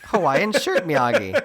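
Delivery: name the doll like a toy line, with a laugh; toy-doll announcer. Acted. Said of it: "I imagine Miyagi is like a Barbie doll".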